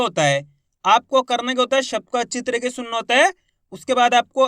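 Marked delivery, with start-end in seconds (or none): none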